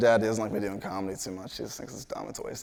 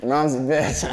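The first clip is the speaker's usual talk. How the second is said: imitated jeer, slightly raised voice; heckling; 3 semitones higher, 5 dB louder than usual. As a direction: heckle like a dad at a kids' game. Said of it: "he was heckling me".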